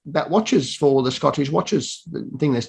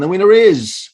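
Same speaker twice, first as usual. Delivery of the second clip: louder, higher big-reveal announcement; the final envelope-opening line, louder and higher; awards announcer; 5 semitones higher, 5 dB louder than usual. Acted. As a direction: hold the suspense, then land it.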